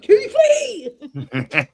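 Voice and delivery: laughing, high singsong Keithy; mock him with a sing-song nickname; teasing nickname. Friend teasing Keith with his childhood nickname, laughing singsong. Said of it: "I always hated that when I was a kid".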